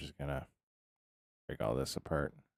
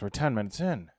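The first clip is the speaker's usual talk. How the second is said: ordinary talk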